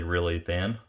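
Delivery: normal talking voice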